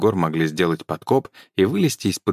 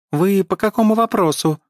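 high, nasty, squeaky little elf; 13 semitones higher, 4 dB louder than usual. A narrator's reading. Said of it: "противным голосом пропищал эльф".

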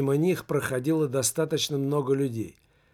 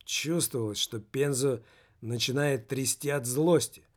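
ordinary delivery

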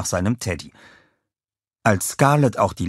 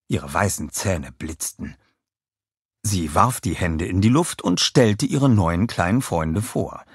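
ordinary delivery, nothing marked

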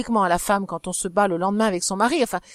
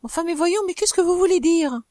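high, startled disbelief; shocked disbelief; 8 semitones higher than usual. Acted. Wife hearing of the duel, high shocked question. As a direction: cry out in alarmed surprise.

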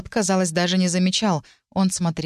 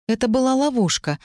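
high, exhaled admission; raised pitch, falling away at the end; breathed-out confession; 7 semitones higher than usual. A narrator's reading. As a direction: let it out in one breath. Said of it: "выдохнула я".

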